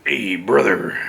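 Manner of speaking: a hint of a low Macho Man; understated Macho Man greeting; lightly voiced Macho Man. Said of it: "he goes".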